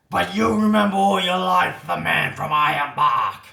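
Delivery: wounded bushman bellowing at the barber; desperate yell. Acted. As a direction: roar it like a man fighting for his life. Bushman thinks throat is cut.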